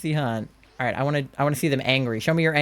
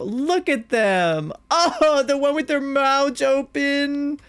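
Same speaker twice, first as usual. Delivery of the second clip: much higher, slow doting voice; high cooing; 9 semitones higher, slower than usual. Real, not acted. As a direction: coo like at a newborn. Gushing at photo.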